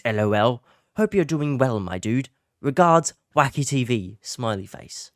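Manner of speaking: put-on British accent, emphatic delivery. Finishing the friend's reassuring email in a British accent. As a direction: read it out like a Brit. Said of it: "I'm gonna do a British accent".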